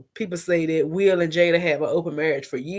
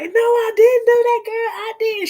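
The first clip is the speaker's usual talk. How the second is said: squeaky high mock denial; high playful fake-innocent protest; 3 dB louder than usual. Jada laughing off the accusation, high mock denial. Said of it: "And Jada was like".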